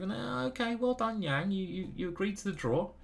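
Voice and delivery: high, softened congratulation; high-pitched concession. Real, not acted. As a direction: lift the voice high, keep it light. Surprised that Yang accepted draw.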